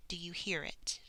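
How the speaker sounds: normal talking voice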